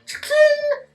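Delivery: high-pitched ringing cha-ching; bright high "ching" effect; imitated cha-ching. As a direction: make a high metallic clink with the voice. Imitates handcuffs closing.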